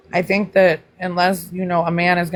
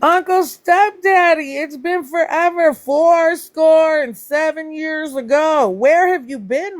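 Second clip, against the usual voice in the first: high, drawn-out, hollered welcome; big excited greeting; 8 semitones higher, slower than usual. Real, not acted. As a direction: hail a long-lost friend, slow and high. Hailing a long-absent viewer.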